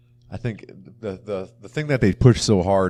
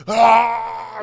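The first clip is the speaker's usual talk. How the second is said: high, loud hammed-up cry of grief; mock anguished scream; 8 dB louder than usual. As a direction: wail like a cartoon villain losing his dad. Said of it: "and he's like".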